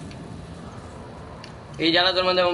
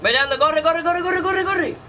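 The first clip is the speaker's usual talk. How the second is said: frantic, very high-pitched yelling; screaming at them to run; being chased; 11 semitones higher, slower than usual. Real, not acted. (gritando desesperado, muy agudo)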